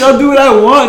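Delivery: very high, loud mock backtalk; hammed-up bratty defiance; acted defiant shout. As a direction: imitate a kid loudly refusing orders. Friend defies the scolding lady.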